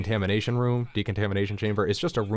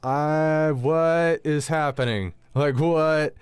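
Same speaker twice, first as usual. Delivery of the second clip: bewildered; 7 semitones higher, 4 dB louder, much slower than usual. Retold or imitated. Stunned reaction to her screaming.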